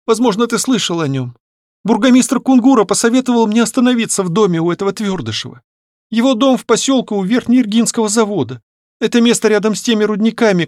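put-on high-pitched girlish voice, explaining; earnest, agitated account to her father; distressed young daughter. Voices Varya telling her story. (взволнованно, высоким девичьим голосом)

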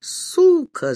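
very high-pitched acted squeal; screeched insult. A narrator's reading. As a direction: screech it, voice leaping right up. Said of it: "заверещал тот".

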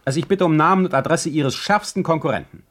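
sly goading. Acted. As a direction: needle him with glee. Sly taunting of notary.

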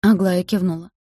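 usual speaking voice

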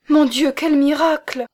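high, loud cry of relief; overjoyed cry. Acted. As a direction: burst out in grateful wonder. Adeline kneels, overjoyed at rescue.

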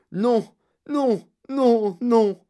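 distressed protest. Acted. Candy maker wringing his hands over gum, distressed. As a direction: cry out each word separately in dismay.

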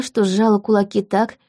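plain reading voice

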